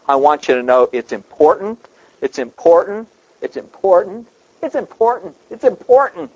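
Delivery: preacher hammering it's important, rising each time; building repetition. Shown as a demonstration. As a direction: climb step by step with every repeat. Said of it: "when God emphasizes something six times in a row".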